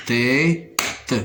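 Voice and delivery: "Tête" in two separate beats, tê, then te; split into syllables. Shown as a demonstration. Teacher counting syllables for learners, "Tête" split in two. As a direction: say "Tête" slowly syllable by syllable, pausing before the final te. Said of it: "On va découper les mots en syllabes".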